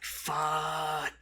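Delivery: long drawn-out curse of dismay; mock despair. Stranded traveler realizing where he landed, mock despair. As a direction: draw the word out in comic despair.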